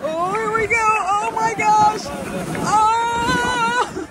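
high, drawn-out excited squeal of amazement; excited cry as the ride takes off; thrilled. Real, not acted. Rider as the dog sled takes off, thrilled.

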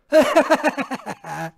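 long rapid villain laugh; staccato gloating laughter; triumphant cackle. Acted. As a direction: cackle on and on in victory.

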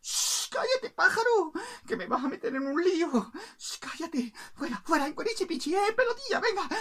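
high, urgent shushing; flustered cook. Acted. Cook hushes the bird while plucking, high and flustered.

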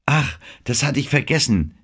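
apologetic. A narrator's reading. Anne apologizes to Denham for forgetting the mountain, apologetic. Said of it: "entschuldigte sich Anne".

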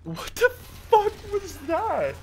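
very high, slow, cracking shriek; shrieking disbelief. Real, not acted. Gamer shrieks at friend over his streak, disbelieving. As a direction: voice breaking with disbelief.

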